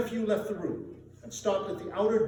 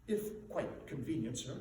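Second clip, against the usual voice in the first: softer, lower meek reply; timid clerk; 4 semitones lower, 7 dB quieter than usual. A narrator's reading. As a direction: ask almost apologetically. Timid clerk answers Scrooge.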